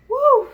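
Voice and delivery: high, loud woo; reenacted cheer; celebratory whoop. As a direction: let out a happy whoop. Cheering the baby's arrival.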